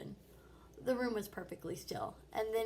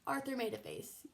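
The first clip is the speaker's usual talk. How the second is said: plain reading voice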